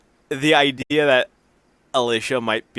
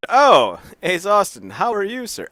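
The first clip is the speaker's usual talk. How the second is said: mock polite greeting; 7 semitones higher, 5 dB louder than usual. Acting out polite security guys.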